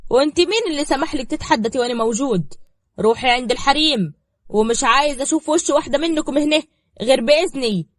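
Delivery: loud, high, furious order; angry outburst. A narrator's reading. Diab orders Zahra back to the women, furious. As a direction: put her in her place, shouting. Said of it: "دياب اتدخل بعصبية".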